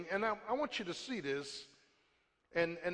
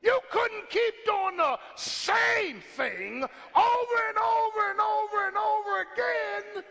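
high, slow shouted cry breaking with emotion; 11 semitones higher, 6 dB louder, slower than usual. Real, not acted. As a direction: wail it out, slow and pleading. Preacher shouting, voice crying out.

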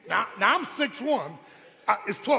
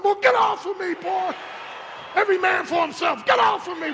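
loud, higher comic yelling; father yelling at his son in the water; mock yell; 8 semitones higher, 4 dB louder than usual. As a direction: shove the kid off, half joking. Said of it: "I said".

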